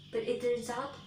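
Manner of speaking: plain reading voice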